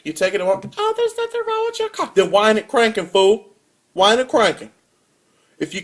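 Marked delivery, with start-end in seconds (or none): 0.8-2.1 high falsetto dismissive mechanic; mocking the mechanic. Imitating the Walmart mechanic to the customer, high mock voice. 2.1-4.7 mock angry. Arguing back at the mechanic.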